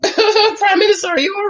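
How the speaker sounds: laughing high through the words. Real, not acted. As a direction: burst out laughing at the scene. Laughs at the scene.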